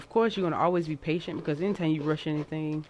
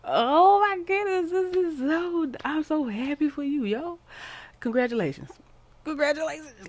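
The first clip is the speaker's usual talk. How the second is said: overjoyed squeal; 11 semitones higher, 5 dB louder than usual. Real, not acted. Reader overjoyed for the viewer, high squealing gush. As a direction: gush with joy for them.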